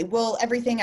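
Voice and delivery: ordinary delivery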